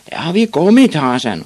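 high, loud schoolboy call; jeering shout. A narrator's reading. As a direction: holler the taunt across a field. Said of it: "råbte nogle af drengene".

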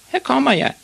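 put-on high boy's cry; high-pitched child's yell; screamed warning. A narrator's reading. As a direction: call out very high while careering along. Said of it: "skreg Ivan".

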